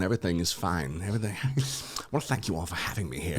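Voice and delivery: softly voiced celebrity impression; quiet, understated take on the actor's voice; Christopher Walken. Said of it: "Christopher Walken has got, has got this nice voice".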